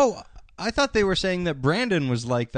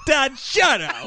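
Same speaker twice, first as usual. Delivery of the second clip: mock teen shout; 6 semitones higher, 3 dB louder, slower than usual. Mock teen yelling at dad.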